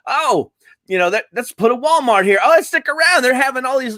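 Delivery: lightly mock the Ohio relative; imitating Damon. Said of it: "Damon was like".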